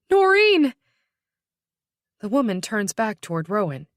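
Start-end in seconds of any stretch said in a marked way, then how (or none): 0.1-0.7 louder, higher, desperate cry; calling out. A narrator's reading. Woman calling to her wounded sister, crying out loudly. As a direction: call the name with all your voice. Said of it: "she calls".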